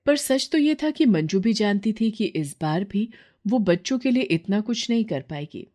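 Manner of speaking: plain reading voice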